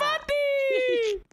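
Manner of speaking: piercing, very high shriek of the name; screaming cheer. Real, not acted. Celebrating her friend's jump, long shrieking cheer.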